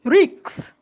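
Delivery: "risks" pronounced riks, K ahead of S; wrong cluster order. Shown as a demonstration. Teacher demonstrates a mistake to learners, cluster swapped. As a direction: swap the final sounds of "risks" to make riks. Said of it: "that is K, before S".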